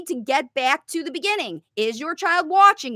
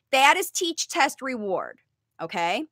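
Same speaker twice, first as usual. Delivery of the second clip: ordinary talk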